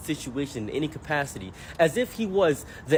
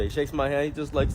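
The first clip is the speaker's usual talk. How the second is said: ordinary delivery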